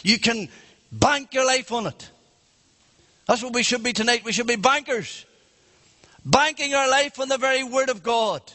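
high-pitched, forceful pulpit preaching; impassioned preaching. Real, not acted. Preacher urging the congregation to bank on God's word, impassioned. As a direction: drive each phrase home to the congregation.

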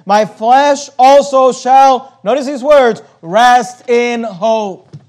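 drawn out, stately verse; preacher savouring the verse; slow scripture reading. Acted. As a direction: linger on every word. Preacher slowly declaims verse.

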